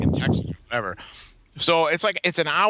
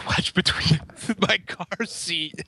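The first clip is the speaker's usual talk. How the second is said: laughing through the punchline, slow; can barely get it out for laughing; giggling at own bad luck; 3 semitones higher, much slower than usual. Real, not acted. Punchline of wallet story.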